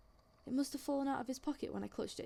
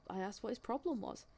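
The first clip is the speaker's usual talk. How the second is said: ordinary talk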